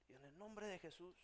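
softly intoned pious prayer; spiritual praying. Acted. Preacher showing how he starts praying, soft spiritual prayer. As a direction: pray it quietly, very spiritual. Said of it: "empiezo a orar así, bien así, espiritual".

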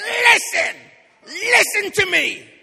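full-force high furious shout; angry wife. Retold or imitated. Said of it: "She was angry".

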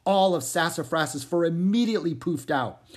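ordinary delivery

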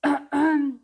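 loud throat clearing. A narrator's reading. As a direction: clear the throat before the spell. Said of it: "se aclaró la garganta".

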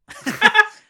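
high, loud burst of laughter; shrieking laugh. Real, not acted. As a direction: let out a squealing cackle. Big laugh after joke.

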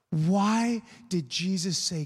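ordinary talk, nothing marked

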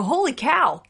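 put-on "Holy cow" in a high American accent, stress on "Holy"; mock American surprise; American exclamation. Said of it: "Or in America, you might go".

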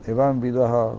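ordinary talk, nothing marked

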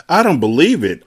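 a bright burst of amazement; excited exclaim. A narrator's reading. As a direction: gasp it out in disbelief. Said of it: "I exclaimed".

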